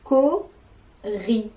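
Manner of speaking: cori said as two clear syllables, co-ri. Shown as a demonstration. Teacher presenting the new animal name cori. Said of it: "J'ai inventé l'animal".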